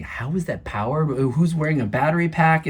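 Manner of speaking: loud, amused wondering question; half-laughing, puzzled outburst; excited wondering. Retold or imitated. Retold own surprised thought.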